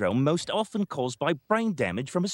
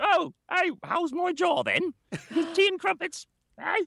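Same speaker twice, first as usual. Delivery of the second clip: hammed-up English accent, much higher; mock English accent. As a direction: playfully fake a posh English accent. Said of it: "she started speaking with an English accent".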